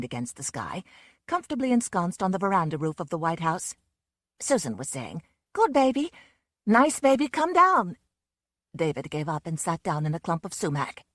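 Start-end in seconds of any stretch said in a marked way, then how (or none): 5.5-8.0 high, loud sweet-talking to a big cat; coaxing. Acted. Susan coaxing the panther down from the roof, high loud coaxing. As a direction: coax the animal down, higher and louder.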